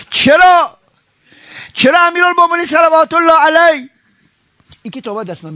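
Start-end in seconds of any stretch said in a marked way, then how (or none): 0.1-3.9 rhetorical cry. Acted. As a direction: call out the question to the crowd.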